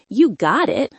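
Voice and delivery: ordinary delivery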